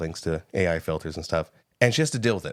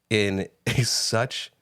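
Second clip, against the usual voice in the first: ordinary talk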